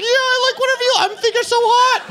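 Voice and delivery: put-on very high falsetto squeak of a nervous teen; squeaky eager voice. Teen answers the girl he likes, high squeak. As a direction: squeak it out like a starstruck boy. Smitten teen says yes again.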